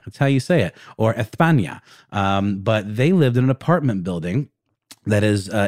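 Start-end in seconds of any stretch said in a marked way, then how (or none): none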